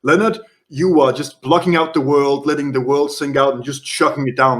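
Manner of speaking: normal talking voice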